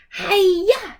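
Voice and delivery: loud, high karate shout; battle cry. Acted. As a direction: unleash the super kick. Super kick battle cry.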